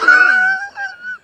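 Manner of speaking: sudden high whooping laugh; burst of laughter. Real, not acted. Streamer cracks up as the cockatoo squawks, loud high laugh. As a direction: crack up loudly at the bird.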